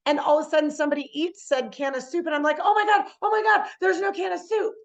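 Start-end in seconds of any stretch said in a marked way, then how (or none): 2.6-4.7 high-pitched, loud alarmed outburst; hammed-up, frantic dismay; mock panic. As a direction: panic over a small disaster. Acted panic over missing soup.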